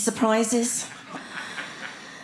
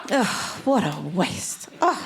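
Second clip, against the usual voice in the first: mock devastation; 4 semitones lower, slower than usual. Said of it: "devastated I was".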